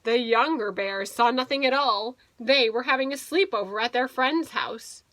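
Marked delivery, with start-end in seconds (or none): none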